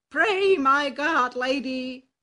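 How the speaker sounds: loud, bold donkey voice; a confident ass calling out to a friend; hearty donkey. Acted. Ass addresses the cat.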